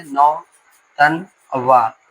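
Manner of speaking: नौ तन वा slowly, each syllable on its own. Shown as a demonstration. Teacher asking students to count syllables, spacing each one out. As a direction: pause between नौ, तन and वा. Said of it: "ये कितने syllable है?".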